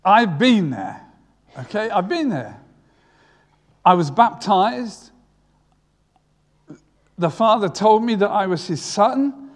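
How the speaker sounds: a hint of Jesus telling the lads firmly; emphatic voicing of Jesus. Imagined Jesus telling his disciples, mildly emphatic. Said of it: "I can imagine Jesus at this point saying".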